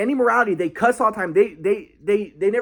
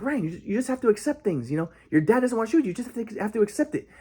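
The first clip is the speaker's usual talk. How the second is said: higher, softer voice imitating grandpa; lightly mock grandpa's passive advice, pitched up; mock soothing grandpa; 4 semitones higher, 4 dB quieter than usual.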